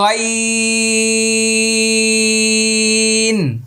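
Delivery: গইন held very long and high from the back of the throat; drawn-out letter ghain. Shown as a demonstration. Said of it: "ওকে, আবার লক্ষ্য করুন". (গইন দীর্ঘ টেনে উঁচু সুরে উচ্চারণ)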